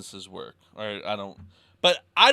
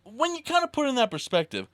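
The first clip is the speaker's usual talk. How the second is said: exasperated. Real, not acted. Exasperated rant about business.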